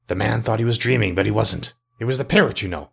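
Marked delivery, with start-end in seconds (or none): none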